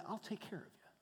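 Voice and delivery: tender hush. Acted. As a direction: comfort them softly as God. God comforting his people.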